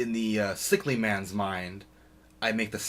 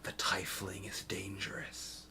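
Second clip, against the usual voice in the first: telepathic whisper; 11 dB quieter than usual. Acted. Telepathic message into a mind.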